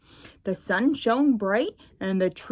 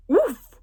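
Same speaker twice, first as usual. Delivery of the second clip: a high, loud startled oof; a bumped-into grunt, high and loud; 10 semitones higher, 5 dB louder than usual. Acted. Owl bumping into Tigger, high loud oof. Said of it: "cried Owl".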